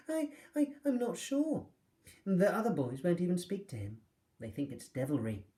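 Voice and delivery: high, stammering, uneasy voice; flustered and scared; nervous stammer. Acted. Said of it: "gave a nervous laugh".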